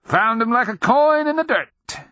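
put-on high, loud crowing old-man voice; mischievous old man. A narrator's reading. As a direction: crow it with mischief. Mischievous sergeant crows.

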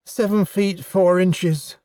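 slow, high-pitched pride; proud. A narrator's reading. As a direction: announce it proudly, savouring each word. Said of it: "science officer said proudly".

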